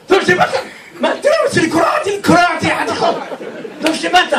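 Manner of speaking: high, fast, near-shouted panic; panicked plea. Acted. Frightened man begs Rabbi Meir for rescue, panicked shout. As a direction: blurt it out in terror, pitched high.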